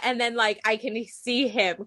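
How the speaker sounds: ordinary talk, nothing marked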